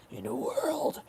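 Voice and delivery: mock horror-trailer announcer, high and soft; put-on trailer voice, drawn to a rise; movie trailer voice. Joking about a laryngitis trailer voice, mock announcer. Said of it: "horror film movie trailer".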